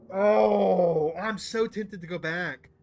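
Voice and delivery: long, loud, high groan; agonized temptation. Real, not acted. Streamer torn over going back for strength, agonized groaning. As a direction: wail it out slowly, torn.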